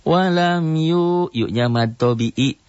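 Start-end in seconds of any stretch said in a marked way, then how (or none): 0.0-1.3 slow, high melodic tartil chant; Quran recitation. Shown as a demonstration. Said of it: "teacher recites Quran verse". (lantunan tartil pelan)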